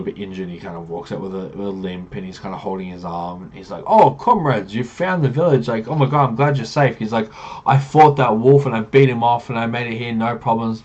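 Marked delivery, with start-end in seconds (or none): 3.9-7.0 high, loud acted relief; hammed-up delighted welcome; effusive greeting. Injured villager hails his comrades. 7.7-10.8 acted boasting. Villager boasts of beating wolf.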